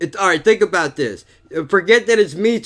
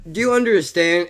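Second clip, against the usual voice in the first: usual speaking voice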